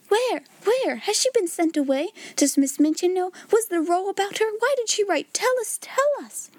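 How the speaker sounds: high, excited volley of questions; clamouring babel. A narrator's reading. Pupils besiege Ermengarde for news, high excited clamour. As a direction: fire off each question high, ending in a pleading cry. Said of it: "There was a perfect babel".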